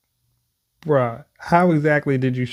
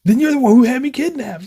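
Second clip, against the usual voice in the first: accusing outburst; 12 semitones higher, 6 dB louder than usual. Acted. Captive accuses the boss.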